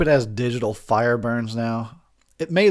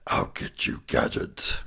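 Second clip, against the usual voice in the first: raspy whispered cartoon villain; cartoon villain threatening his nemesis in a rasp; Doctor Claw impression; 7 dB quieter, slower than usual. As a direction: hiss it like a scheming cartoon villain. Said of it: "my Inspector Gadget impression".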